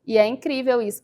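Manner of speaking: ordinary delivery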